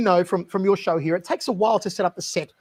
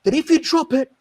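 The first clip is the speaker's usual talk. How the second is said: mock-dire warning, louder toward the end; Steven Seagal; 6 semitones higher, 4 dB louder than usual. Star warning the producer of dangerous things, intense imitation.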